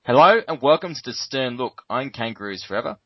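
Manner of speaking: ordinary talk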